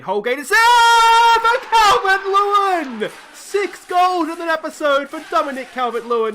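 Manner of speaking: ecstatic goal call. Real, not acted. Gamer roaring his striker's sixth goal, ecstatic. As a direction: call it like a TV commentator.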